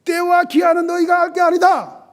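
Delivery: high, loud pronouncement that shuts them down; embarrassing rebuke. Acted. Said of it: "아주 민망하게 만듭니다". (무안하게 높고 큰 목소리로 꾸짖듯)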